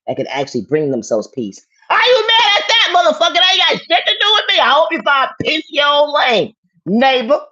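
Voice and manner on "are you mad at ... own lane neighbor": high, loud, crying-edged yell; furious shout. Real, not acted. Yelling at the harasser, high and furious. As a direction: scream it at them, near tears.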